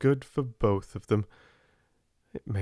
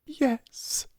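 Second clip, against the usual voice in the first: passionate. A narrator's reading. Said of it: "in a new passionate way".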